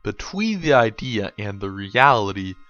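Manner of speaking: hollow-men lament, read gravely; solemn poem recitation. A narrator's reading. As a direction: intone it like a dirge. Solemn poem recitation over music.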